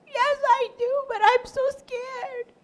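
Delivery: high, small frightened voice; scared, tearful. Acted. Crying girl answers Amy, scared and tearful. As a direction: act a weepy child, high and soft. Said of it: "crying and crying".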